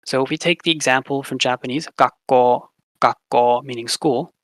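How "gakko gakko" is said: "gakko" with the K held before release and a long final O, gak-koo; long consonant and vowel. Shown as a demonstration. Teacher to class, showing Japanese gemination: held K, long O. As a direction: pause on the K of "gakko", stretch the O. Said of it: "you hold the K sound for longer".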